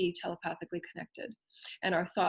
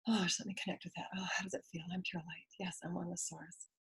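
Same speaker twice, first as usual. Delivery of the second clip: soft breathy whisper to oneself; inner whisper; 14 dB quieter than usual. Pretend. Student answering the teacher softly in whisper. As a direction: whisper as if connecting inward.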